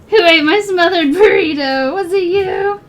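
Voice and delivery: loud, playful accusation; hammed-up outrage; mock accusing. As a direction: demand an answer in jest.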